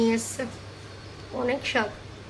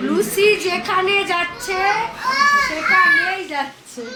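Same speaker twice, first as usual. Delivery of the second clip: higher, slow sing-song pet talk; cooing to the puppy; 6 semitones higher, slower than usual. Real, not acted. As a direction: coax the little dog along sweetly.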